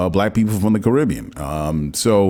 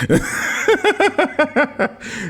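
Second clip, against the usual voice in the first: big laugh. Real, not acted. Laughs at slave-master joke.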